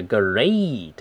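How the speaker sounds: GREAT with a stretched "ay" glide; long A. Shown as a demonstration. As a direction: draw out the vowel in GREAT slowly. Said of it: "那个A又是长音哈". (GREAT 的A读成长音)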